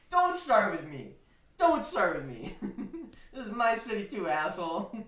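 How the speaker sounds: loud retold scolding with laughs; hammed-up angry rant; 1 loud telling off. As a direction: lay into him, half laughing.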